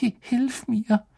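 high, slow, stammered plea; frightened moan. A narrator's reading. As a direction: moan for help in fear. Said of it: "stöhnte er Hermine zu". (ängstlich gestöhnt, hoch und stockend)

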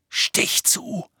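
urgent whispered order; tense, voiceless command. A narrator's reading. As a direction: whisper it sharply, urging him to strike.

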